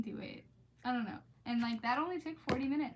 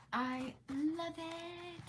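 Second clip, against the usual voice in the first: dreamy gush; 7 semitones higher, much slower than usual. Real, not acted. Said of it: "dreamy gush".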